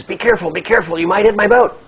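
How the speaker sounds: loud, high alarmed call; acted urgent shout, building; shouted warning. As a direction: yell across the water to another boat. Farmer calling warning to oncoming boat.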